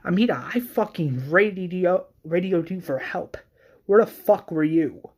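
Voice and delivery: heated, high-pitched reproach; acted screaming. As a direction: confront someone who let you down. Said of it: "she's screaming, saying".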